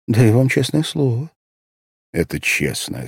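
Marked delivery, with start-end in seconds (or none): 0.1-1.3 put-on higher earnest vow of a girl; girl's earnest promise. A narrator's reading. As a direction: swear it like a child, sincerely. Asya's promise vs narrator echo.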